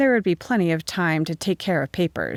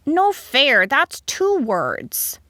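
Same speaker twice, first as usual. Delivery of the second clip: put-on high child's objection, loud at the start; indignant schoolboy protest, pitched up; 8 semitones higher, 4 dB louder, much slower than usual. A narrator's reading. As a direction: protest loudly, drawing out the last words. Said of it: "Toby protested loudly".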